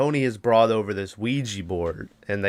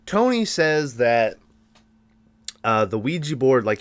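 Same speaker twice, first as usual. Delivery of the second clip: ordinary delivery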